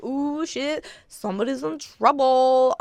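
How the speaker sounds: ooh, gleeful gossip; amused surprise. Retold or imitated. Hearing the security alarm, high mock surprise.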